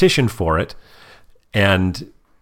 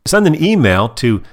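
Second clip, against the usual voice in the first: voice command; 8 semitones higher than usual. Shown as a demonstration. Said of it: "then just say, "Hey Siri".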